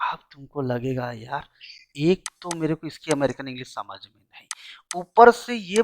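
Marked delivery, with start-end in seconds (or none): 0.1-4.5 whisper. Acted. Aside to the class, confiding.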